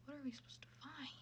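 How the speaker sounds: fast unvoiced whisper. Real, not acted. Streamer whispering late at night. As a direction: whisper it to yourself.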